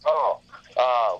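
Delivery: normal talking voice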